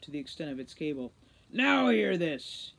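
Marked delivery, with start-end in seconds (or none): 1.5-2.7 slow, loud growl into the mic; growled command. A narrator's reading. Root growls into the terminal microphone. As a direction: grab the room's attention, slowly. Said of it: "he growled".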